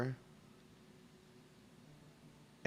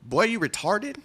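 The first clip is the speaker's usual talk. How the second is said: high, rising taunt; hammed-up mocking question; mock Mikazuki; 11 semitones higher, 5 dB louder than usual. Friend Mikazuki mocks the streamer's pronunciation with a high taunt. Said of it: "Mikazuki is gonna come up and be like".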